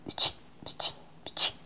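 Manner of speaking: soft unvoiced pchit to a cat; whispered cat call. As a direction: hiss softly to lure the kitten. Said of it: "La señora dijo".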